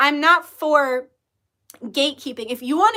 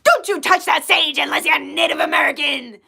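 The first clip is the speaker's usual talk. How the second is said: mock scolding; 3 dB louder than usual. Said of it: "there was nobody in there saying".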